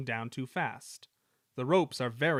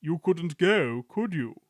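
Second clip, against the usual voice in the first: sly hint; 4 semitones higher, 6 dB louder, slower than usual. A narrator's reading. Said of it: "he winked".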